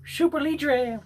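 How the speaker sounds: high, hammed-up announcer call of Super Libre; mock announcer. Calling out a brand name.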